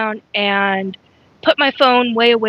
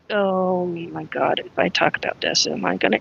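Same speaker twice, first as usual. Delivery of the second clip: worried aside; 5 semitones lower, 6 dB quieter than usual. Real, not acted. Dreading talking about the topic.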